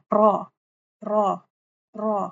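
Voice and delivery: را repeated with a doubled, over-rolled r; wrong raa. Shown as a demonstration. Teacher demos the mistaken multiple-roll را to students. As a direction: drill را with too many trills of the r. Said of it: "اس طرح سے نہیں پڑھنا".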